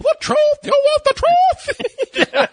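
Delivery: slow, squealing falsetto parody; hammed-up, drawn-out movie-quote squeal; mock courtroom outburst. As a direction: throw the line back in a silly high shriek.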